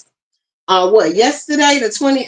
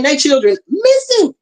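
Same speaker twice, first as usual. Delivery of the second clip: tearful; 6 semitones higher than usual. Real, not acted. Upset about missing children.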